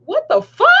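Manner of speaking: high, startled question; retold shock. Mother seeing three police cars pull up, shocked. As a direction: jump up high in mock alarm.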